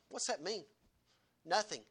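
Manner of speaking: normal talking voice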